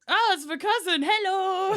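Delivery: high, loud mock cheery hello; mock cheery greeting. Viking greets his cousin across the battlefield, high loud cheery. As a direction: call out a delighted hello, hammed up.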